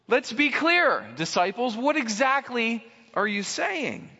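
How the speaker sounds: preacher confronting the disciples, building fervour; preaching. Acted. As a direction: press the point hard on the congregation. Preaching at full force.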